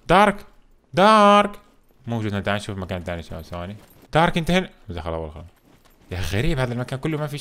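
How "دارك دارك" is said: raised, louder questioning call. Real, not acted. Looking for missing friend Dark, calling out. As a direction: call out for someone out of sight.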